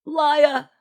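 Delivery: high, narrated shout; high-pitched outraged yell; shouted accusation. A narrator's reading. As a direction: cry it out as an accusation. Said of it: "Genevieve shouted".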